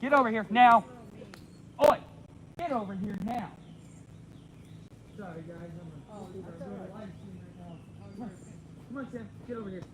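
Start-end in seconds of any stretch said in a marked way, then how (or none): 0.0-3.5 distant call to a dog; calling from far away. Real, not acted. I gotta go deal with this. 8.1-9.8 distant call to a dog; calling from far away. Real, not acted. I gotta go deal with this.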